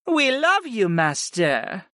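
high start dropping on Master; goddess chiming in. A narrator's reading. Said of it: "Sarah chimed in".

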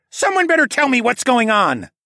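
loud, high-pitched angry demand; demanding shout. A narrator's reading. Tawa to an angry mob, demanding shout. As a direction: demand answers at the top of your voice. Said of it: "Tawa demanded".

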